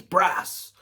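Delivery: calling him Brass like his brother does; imitating brother. Brother ringing out of the blue, drawn-out greeting. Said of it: "That's how he talks".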